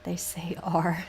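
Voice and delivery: slow voiceless whisper. Real, not acted. Telling chat how Southerners say R, whispering. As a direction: whisper it slowly, like a secret. Aside on pronunciation.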